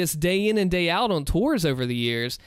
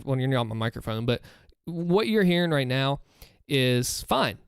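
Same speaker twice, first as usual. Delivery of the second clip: normal talking voice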